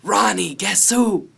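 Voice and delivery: high, loud father's holler; big singsong call through the house. Acted. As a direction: shout for your son in another room. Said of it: "my father calls out".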